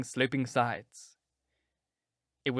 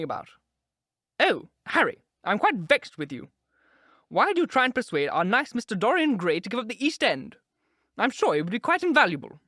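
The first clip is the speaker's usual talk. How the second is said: fussy aunt; 8 semitones higher, 4 dB louder than usual. A narrator's reading. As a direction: scold the men like a flustered aunt. Lady Agatha voiced, fussing.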